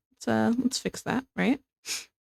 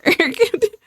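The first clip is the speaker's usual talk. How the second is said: high cackling laugh, real; burst of laughter; 12 semitones higher, 5 dB louder than usual. Real, not acted. Laughing at her own question to chat. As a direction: breaks into a loud giggle.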